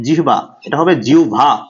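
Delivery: ordinary talk, nothing marked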